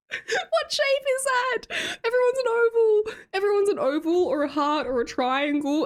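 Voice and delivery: high, whiny laughing lament; mock wailing. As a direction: wail in mock despair through laughter. Mock despair over face shape, laughing.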